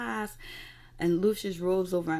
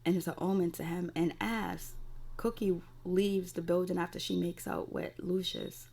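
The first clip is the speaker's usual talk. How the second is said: ordinary delivery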